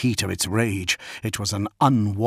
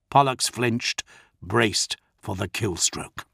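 plain reading voice

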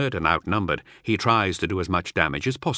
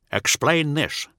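a raised, demanding voice; word-bearer demanding; 8 semitones higher, slower than usual. Acted. Word-bearer demanding an explanation from his subordinate. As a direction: press him for answers. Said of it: "says the word-bearer".

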